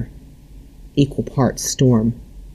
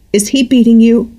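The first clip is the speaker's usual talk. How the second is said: high, louder anxious mother's question; worried mother; 11 semitones higher, 6 dB louder than usual. A narrator's reading. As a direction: press her with alarm. Mother confronts daughter about David.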